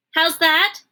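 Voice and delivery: high, loud puzzled shout; shouting Midge. A narrator's reading. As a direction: yell it like she can't hear. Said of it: "shouted Midge".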